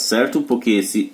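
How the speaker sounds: usual speaking voice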